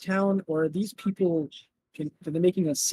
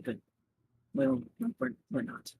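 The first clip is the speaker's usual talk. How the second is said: hushed aside fading softer at the end; whispered aside; 6 semitones higher, 7 dB quieter than usual. Acted. Player whispering behind Josiah's back, hushed aside. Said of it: "whisper in his direction".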